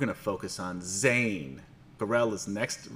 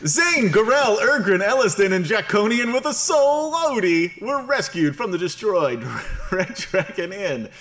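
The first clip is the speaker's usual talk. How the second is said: much higher, louder grand narrator; 12 semitones higher, 10 dB louder than usual. Acted. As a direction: boom it out like a cartoon announcer. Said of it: "my narrator voice now".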